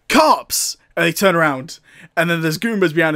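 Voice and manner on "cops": a sudden higher yelled alarm; mock shout. Brothers spotting the cops, mock shout. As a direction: blurt it out like a startled cartoon hero. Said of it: "and they shout".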